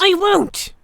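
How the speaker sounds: furious refusal blurted out; blurted defiance. A narrator's reading. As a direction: spit it out through clenched teeth. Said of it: "I blurted out".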